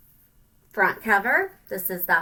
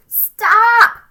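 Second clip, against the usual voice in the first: high, loud sharp cry; shout; 12 semitones higher, 16 dB louder than usual. Acted. Littlest ant shouting at the marching ants. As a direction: yell to halt the line.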